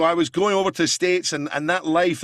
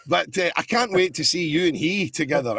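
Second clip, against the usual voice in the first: ordinary delivery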